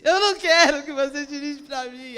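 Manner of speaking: mock-distressed, forceful protest; upset refusal. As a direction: dramatize an upset objection. Acted upset refusal to husband.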